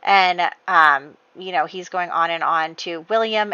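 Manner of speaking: normal talking voice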